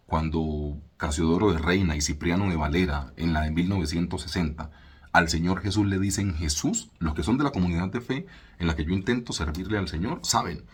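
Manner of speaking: ordinary talk, nothing marked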